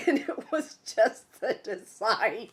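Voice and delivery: high, loud, helpless laughing talk; squealing with real laughter through the words; laughing hard. Real, not acted. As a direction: crack up while telling it. Cracks up retelling the design fee.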